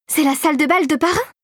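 high, rising astonished exclamation; amazed cry. A narrator's reading. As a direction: cry out in wonder, pitch climbing. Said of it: "s'écria-t-elle".

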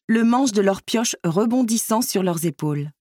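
ordinary delivery, nothing marked

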